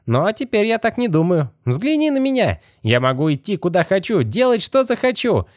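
high, otherworldly skeleton voice. Acted. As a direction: pitch it way up like a rattling skeleton.

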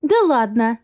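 mildly scoffing exclamation; amused exclamation. A narrator's reading. Heroine scoffing at the men's deal, exclaiming. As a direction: exclaim in disbelief, a touch amused. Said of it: "воскликнула я, рассмеявшись".

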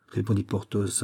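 plain reading voice

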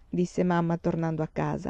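ordinary delivery, nothing marked

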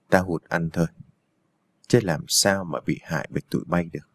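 low, softened villain muttering; gloating villain. A narrator's reading. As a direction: gloat to yourself, low and quiet. Villain gloating after the fight.

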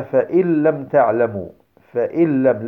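"فإن لم" as "fa-il-lam", no nasal sound; noon merged into laam. Shown as a demonstration. Teacher quoting a verse as example, noon merged into laam. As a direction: recite "فإن لم" with a doubled L for the noon. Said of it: "فنقول".

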